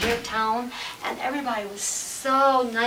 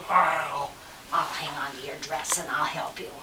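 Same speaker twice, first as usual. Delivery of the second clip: low doggy voice; storybook dog; 8 semitones lower, 5 dB quieter than usual. Acted. Dog offers to help the girl, low voice. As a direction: talk low like a helpful dog. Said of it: "so the dog says".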